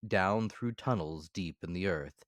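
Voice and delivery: ordinary delivery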